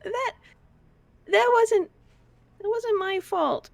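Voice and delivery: put-on a guilty child defending herself; wide-eyed little girl protesting; Missy the little girl. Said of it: "It's like".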